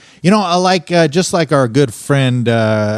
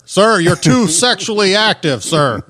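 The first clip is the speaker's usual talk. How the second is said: higher-pitched pretend officer calling out; mock police orders; 6 semitones higher than usual. Police chopper ordering the neighbor to stop, mock commanding. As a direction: call down orders like a pretend cop.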